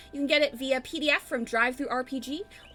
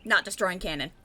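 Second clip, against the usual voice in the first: ordinary talk, nothing marked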